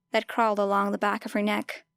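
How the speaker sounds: ordinary delivery, nothing marked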